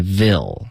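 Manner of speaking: "vil" slowly with a clear short i; mistaken clear vowel. Retold or imitated. Teacher imitating the student's error, slow "vil" with an i. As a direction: copy the slip, "vil" as spelled. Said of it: "你发成".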